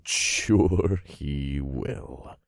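very slow, low sarcasm; snickering scorn. A narrator's reading. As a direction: drag it out mockingly. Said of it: "I snickered".

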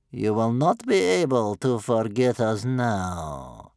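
slow, loud, sinking grandmother voice; gloomy satisfaction. A narrator's reading. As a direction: savour it grimly and slowly. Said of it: "with gloomy satisfaction".

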